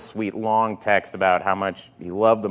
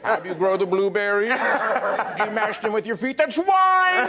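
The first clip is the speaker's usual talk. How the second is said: raised voice climbing to a squeal; high mock questioning; 10 semitones higher than usual. Quiz about growing blueberries, high mock voice.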